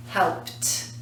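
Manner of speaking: "helped" as soft, low "helpt"; final T sound. Shown as a demonstration. Teacher contrasting sound with spelling, "helped" as "helpt". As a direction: softly say "helped" in one beat ending in "t". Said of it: "but we pronounce it".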